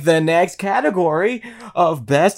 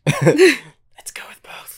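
whispering; 10 semitones higher, much slower than usual. Real, not acted. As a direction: whisper it like a secret. Whispering joke.

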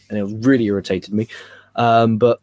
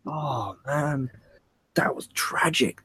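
whispered, much softer; 2 semitones higher, 8 dB quieter, much slower than usual. Retold or imitated. Mock-whispered reaction to seeing the date. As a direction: breathe it as a private groan.

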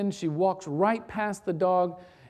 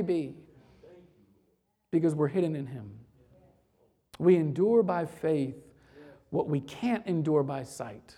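low, soft, measured sermon voice; hushed preaching; 4 semitones lower, 5 dB quieter than usual. Real, not acted. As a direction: let it settle quietly, with long pauses. Preacher drops to hushed appeal.

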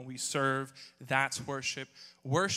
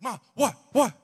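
alarmed reply; 9 semitones higher, 13 dB louder than usual. Retold or imitated. Son answers the shrieking mother.

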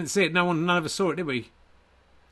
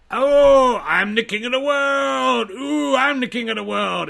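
much higher, slow, shouted triumphant cry; king of the world impression; 11 semitones higher, 9 dB louder, slower than usual. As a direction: bellow it like a hammy king of the world. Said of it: "Impressionist Me ... impression of".

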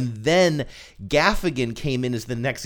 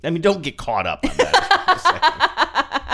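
slow, laughing through the words; giggling dismissal; 10 semitones higher, 4 dB louder, much slower than usual. Real, not acted. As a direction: crack up while brushing it off. Laughing aside.

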